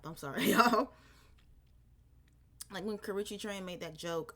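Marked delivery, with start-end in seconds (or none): 0.0-0.9 loud laugh mid-apology. Real, not acted. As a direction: laugh through the sorry.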